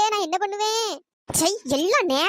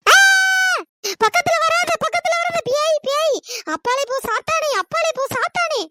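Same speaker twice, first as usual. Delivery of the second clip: terrified shriek; 7 semitones higher, 5 dB louder than usual. Acted. Fleeing the supposed ghost.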